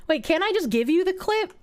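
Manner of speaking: normal talking voice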